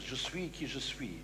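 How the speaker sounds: "Je suis qui je suis" in soft French, "suis" as swi; French reading. Shown as a demonstration. Preacher quoting his French Bible, soft French reading. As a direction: read "Je suis qui je suis" gently with French sounds. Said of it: "in my French Bible".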